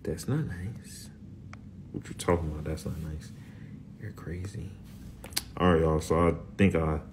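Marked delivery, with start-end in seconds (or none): 0.0-4.7 mock Siri whisper. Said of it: "mimic how the Siri Florida".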